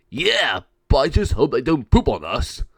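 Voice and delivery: loud cartoon henchman worry; dopey sidekick piping up loudly; goofy gargoyle. Gargoyle two worrying about the flying birds, goofy.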